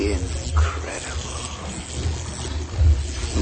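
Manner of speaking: slow breathless whisper of wonder; awed whisper. Acted. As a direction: whisper it in awe, very slowly. Scholar awed by the lamp.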